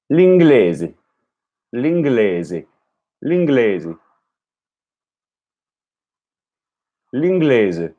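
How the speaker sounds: L'inglese with the L and a final e; repeating Italian. Shown as a demonstration. Learner echoing the tape, careful Italian L'inglese. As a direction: say L'inglese, leen-GLEH-zeh, L attached. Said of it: "trying to make it sound exactly like them".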